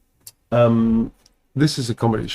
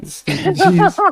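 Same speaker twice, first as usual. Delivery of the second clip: much higher, fast mouse-like squeak; squeaky proclamation; slower than usual. Acted. Voicing her doodle Beardicus, squeaky proud proclamation.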